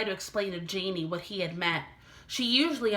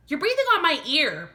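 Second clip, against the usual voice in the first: annoyed complaint; 8 semitones higher, 6 dB louder than usual. Acted. Annoyed boy complains to sister.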